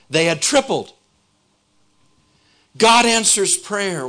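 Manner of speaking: preaching at full force. Real, not acted. Preacher rousing the congregation about answered prayer, full force. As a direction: preach it big, building to the payoff.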